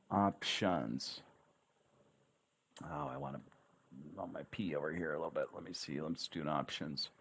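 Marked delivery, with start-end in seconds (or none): none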